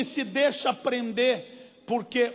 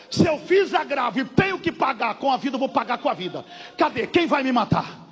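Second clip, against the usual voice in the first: fiery preaching; 5 dB louder than usual. Acted. Pastor scolds the fearful sister, preaching at full force. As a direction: thunder at the congregation.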